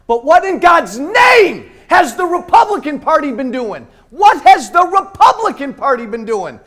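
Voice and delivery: high, loud, angry yelling; furious shout. Real, not acted. Speaker shouting at the crowd about the Republican Party, furious. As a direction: rail against the party at full voice.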